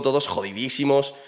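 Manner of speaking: usual speaking voice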